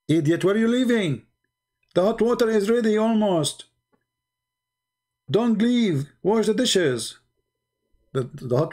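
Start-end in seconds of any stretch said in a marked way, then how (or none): 0.1-7.2 high-pitched imitation of a scolding wife; mock yell, raised in pitch more than volume; mock scream. Wife ordering husband back to the dishes, mock scream. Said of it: "She screamed".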